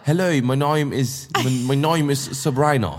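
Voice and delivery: slow, hammed-up British accent; mock textbook British English; english accent in german. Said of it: "englische Serien geguckt haben".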